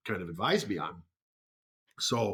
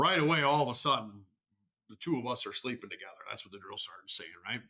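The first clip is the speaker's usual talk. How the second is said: ordinary talk, nothing marked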